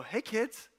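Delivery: softly coaxing mock stranger; a hint of a stranger calling children; pretend stranger. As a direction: lightly act out luring the kids over. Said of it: "pretend that you're not you".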